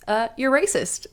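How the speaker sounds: ordinary delivery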